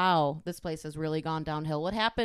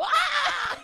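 sudden high laughing shriek; startled scream; 4 dB louder than usual. Real, not acted. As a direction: shriek at the spider. Startled by spider.

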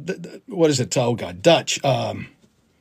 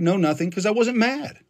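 normal talking voice

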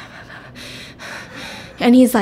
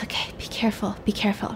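retold soft, whispery caution; hushed warning; 10 dB quieter, faster than usual. She cautions Jay in hiding, hushed warning. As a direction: warn someone under your breath.

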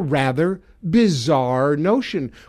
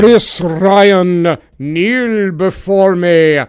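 booming, slow royal decree; King Donald; 5 dB louder, slower than usual. Pretend. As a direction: proclaim it like a pompous tyrant king. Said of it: "I am King Donald".

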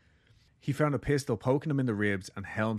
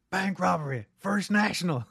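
shouting; 9 semitones higher, 3 dB louder than usual. Retold or imitated. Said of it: "the cops shouting at the same time".

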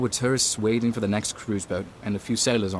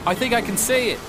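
calling out over storm; 3 dB louder than usual. Acted. Spotting boat in storm.

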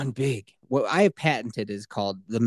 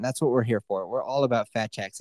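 ordinary delivery

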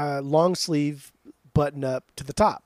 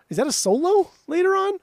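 mocking; 10 semitones higher, 5 dB louder than usual. Real, not acted. Mocking the guitar solo.

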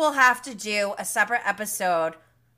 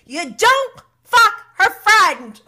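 loud, higher, slow stern mom voice; mom-like scolding; 10 semitones higher, 10 dB louder, slower than usual. Retold or imitated. Host redoing Shayna's line as a scolding mom. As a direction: scold like a mother laying down the law. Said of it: "The way Shayna said this was so mom-like".

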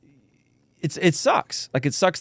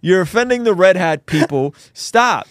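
higher, louder hammed-up outrage; mock scolding; 5 semitones higher, 6 dB louder than usual. Telling his co-host he's offending everyone, mock loud scold. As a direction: scold him loudly, half laughing.

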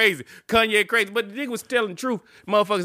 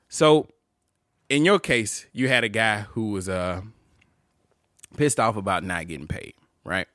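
lower, measured host voice; mock talk show host; 9 semitones lower, 4 dB quieter than usual. Posing as host questioning his guest, mock talk show host. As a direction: lightly play a serious interviewer.

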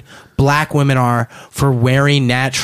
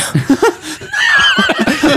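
burst of laughter. Real, not acted. Big laugh after punchline.

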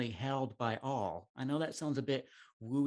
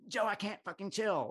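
nervous; 10 semitones higher than usual. Retold or imitated. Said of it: "someone says to you nervously".

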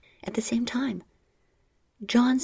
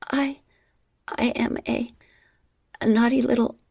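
hesitant, stammering, pitched up; halting; 5 semitones higher, 4 dB louder than usual. Acted. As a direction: stumble over each word. Halting confession.

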